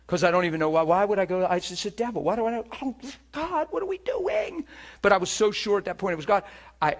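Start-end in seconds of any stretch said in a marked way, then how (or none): 0.1-4.6 tearful mumble climbing to a high whine; increasingly emotional. Retold or imitated. Man nearing the hospital door, increasingly emotional, pitch climbing. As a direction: build from muttered protest to high mock crying. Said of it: "the more emotional I'm getting".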